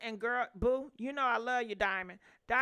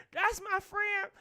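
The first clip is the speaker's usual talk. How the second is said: real laugh, then the words higher through it; 9 semitones higher than usual. Real, not acted. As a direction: laugh it off fondly. Laughs at own roast.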